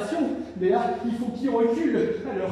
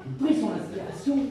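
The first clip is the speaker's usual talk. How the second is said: usual speaking voice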